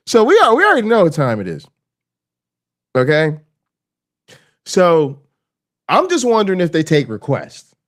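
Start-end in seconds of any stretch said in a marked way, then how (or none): none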